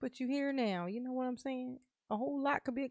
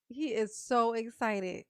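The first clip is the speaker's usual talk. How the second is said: ordinary talk, nothing marked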